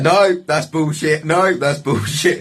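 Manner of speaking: slow, high, mock-indignant no; retold protest. Storyteller to police, slow high indignant protest. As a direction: reenact shouting down the accusation.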